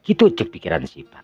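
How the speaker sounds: ordinary delivery, nothing marked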